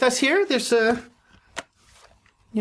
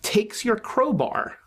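dream narration; 4 semitones lower than usual. Acted.

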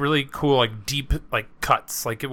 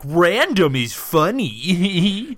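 slow, goofy singsong mockery; mocking silliness; 5 semitones higher, much slower than usual. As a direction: stretch it out like a giddy internet joker. Mocking internet humor of the era.

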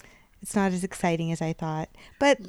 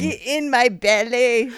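slow, higher Scottish catchphrase; hammed-up Scottish accent; 4 semitones higher, 4 dB louder than usual. Co-host joins the accent game, hammed-up Scottish. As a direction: stretch it out in mock Scottish.

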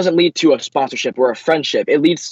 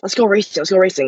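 put-on higher-pitched kid's urging; lightly voiced eager kid, pitched up; 5 semitones higher than usual. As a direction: imitate a child begging to go. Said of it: "as a kid, you're always like".

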